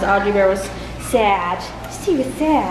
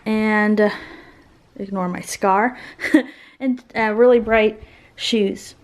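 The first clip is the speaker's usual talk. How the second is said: ordinary delivery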